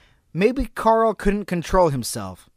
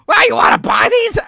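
high, loud creepy clown; evil clown voice; 8 dB louder than usual. Pretend. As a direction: hiss the offer like an evil clown. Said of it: "evil inside this clown".

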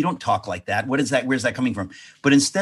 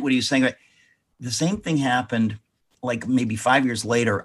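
ordinary delivery

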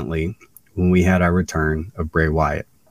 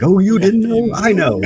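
high, loud catchphrase delivery; hyped fan; 5 dB louder than usual. Retold or imitated. Fan wanting to greet Road Dogg, hyped up. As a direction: burst out with it like a thrilled fan.

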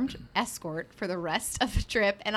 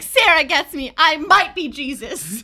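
mock dramatic declaration; 7 semitones higher, 11 dB louder, slower than usual. Retold tantrum, mock.

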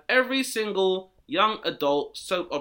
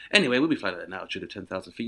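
mock pilot announcement; 8 semitones lower, much faster than usual.

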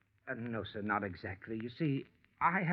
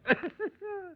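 light laugh. Acted. Shelton laughs again.

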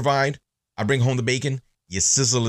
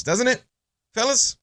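pitched high, calling out to the fellas; rallying appeal; 10 semitones higher than usual. Real, not acted. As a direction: rope the guys in with a high call. Calling out to the male audience.

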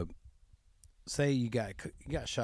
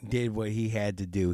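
ordinary talk, nothing marked

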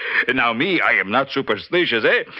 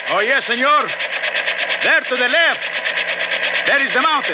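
put-on shouting over engine, Mexican accent; 13 semitones higher than usual. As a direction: point it out at full voice. Shouting over helicopter noise.

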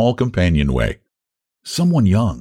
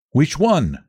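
abrupt, higher-pitched query; boatman's sudden higher question; 7 semitones higher than usual. A narrator's reading. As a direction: ask it out of the blue. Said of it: "asked the boatman suddenly".

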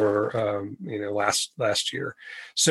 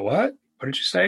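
higher-pitched mock surprise; baffled reaction; 8 semitones higher than usual. People questioning his refusal, higher and baffled. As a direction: ask it like a puzzled listener.

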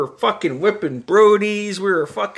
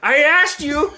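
higher, mock told-you-so; imitating the friend; 10 semitones higher than usual. Mimicking the friend after the crash, a high mock I-told-you-so. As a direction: toss it back in a high mocking voice. Friend's retort in retold story.

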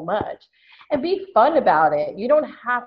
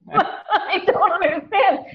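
real laugh breaking into higher-pitched words; laughing disbelief; 8 semitones higher, 4 dB louder than usual. Real, not acted. Baffled that he was upset over a call, laughing disbelief.

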